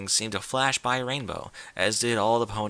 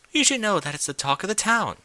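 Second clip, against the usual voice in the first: high, refined, worried lady voice; Rarity asks the distracted Rainbow, concerned; 9 semitones higher, 4 dB louder than usual. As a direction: ask with dainty concern. Rarity compliments.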